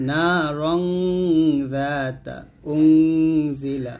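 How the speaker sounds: long hummed ng in "রং", "জুংদুন", "নারং", "উংজিলা"; slow recitation, holding each ng as in "জুংদুন"; nasal ghunnah. Shown as a demonstration. Teacher reciting examples for learners, "জুংদুন" with long steady nasal. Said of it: "অনুচ্ছেদের মতো করে উচ্চারণ করতে হবে".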